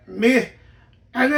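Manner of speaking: ordinary talk, nothing marked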